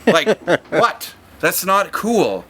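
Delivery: high, slow, offended whine; high offended kid whine; mock offended. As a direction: draw it out like a pouting kid. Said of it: "this is a face I made".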